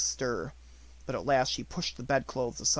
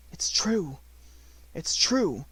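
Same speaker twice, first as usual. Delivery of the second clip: crying out; 3 semitones higher, slower than usual. A narrator's reading. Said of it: "she cried".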